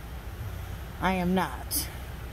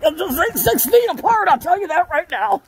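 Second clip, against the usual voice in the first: talking while holding breath; 7 semitones higher than usual. Real, not acted. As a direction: speak on a held breath. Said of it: "I'm holding my breath".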